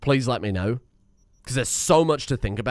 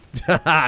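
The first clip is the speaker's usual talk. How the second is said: short burst of laughter; taunting laugh; 3 semitones higher, 5 dB louder than usual. Real, not acted. Laughing at enemies shooting, short taunting burst. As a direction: laugh off the gunfire. Laughs while being shot at.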